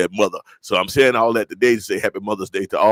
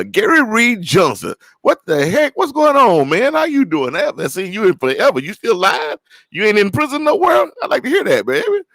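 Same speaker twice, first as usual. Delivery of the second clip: excited surprise; 11 semitones higher than usual. Real, not acted. Host spots an old friend in the chat, thrilled and teasing. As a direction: whoop it up at a long-lost friend.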